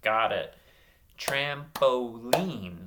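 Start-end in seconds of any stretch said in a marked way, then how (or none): 1.2-2.8 TRAMPOLINE slowly, a gap after every syllable. Shown as a demonstration. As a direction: clap out "Trampoline" piece by piece. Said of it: "clap out some syllables".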